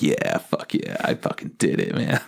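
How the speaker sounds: low, whispered edgy brooding voice; edgy girl. Retold or imitated. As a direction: play it like a cocky edgelord bragging under your breath. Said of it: "like, edgy".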